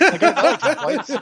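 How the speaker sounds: talking through laughter, high-pitched; laughing. Real, not acted. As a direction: laugh while insisting on it. Joking backtrack amid group laughter.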